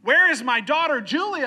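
loud, high demand from a desperate father; shouting. Retold or imitated. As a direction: yell for your missing child. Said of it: "And he's shouting for her name".

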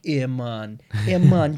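hammed-up Jamaican accent; a quick mock Jamaican accent.

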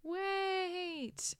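high, drawn-out mock scream; mock cry to halt, held on a high note; imitated scream. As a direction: call it out high and long, only half-screamed. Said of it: "Daphne coming up and screaming".

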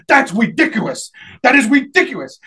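indignant "ridiculous" said as "widiculous"; imitated speech impediment. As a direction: protest loudly, turning the R of "ridiculous" into W. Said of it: "he talked like this".

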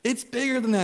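mock the son pleading for the net; insistent son. Son insisting about fish.

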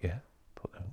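breathy unvoiced reply; hushed yeah; whisper. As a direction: whisper back in agreement. Retold reply whispered back.

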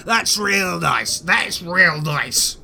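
high, slow, wounded sarcasm; hurt spider. Acted. Spider taking the averted eyes as an insult, slow hurt sarcasm. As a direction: sulk like a sensitive monster.

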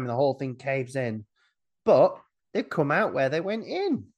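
ordinary talk

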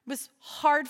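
drawn out slowly, each word weighted; slow emphatic stress on hard fought; slow, heavy emphasis. Real, not acted. Slowed for emphasis.

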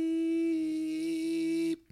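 high mock bleep over the swearing; a squeaky censor bleep; censor beep. Host bleeping out the swears with a beep. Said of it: "You might have to get the beeper out".

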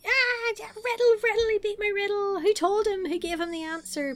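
high, hammed-up screaming fit; screaming tantrum. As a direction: wail it like a sore loser. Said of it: "rolling around screaming like".

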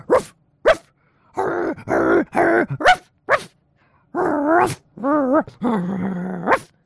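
gruff, growly ruff ruff with hurr growls; real dog barking. Shown as a demonstration. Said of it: "Like that, you know?".

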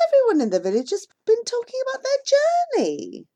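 lightly voiced mahout. A narrator's reading. Mahout welcoming the family back, light high voice. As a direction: greet returning neighbours warmly.

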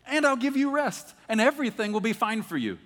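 excited. Real, not acted. Preacher to congregation, excited about the promise. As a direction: lift it up with joy.

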